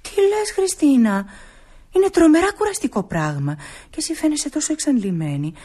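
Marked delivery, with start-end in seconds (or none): 0.0-3.6 dismayed surprise. Acted. Nora reacts to Christina's plan.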